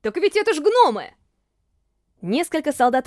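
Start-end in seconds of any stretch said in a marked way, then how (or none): 0.0-1.1 higher, louder outburst; excited exclamation. A narrator's reading. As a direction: blurt it out in amazement. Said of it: "громко воскликнул".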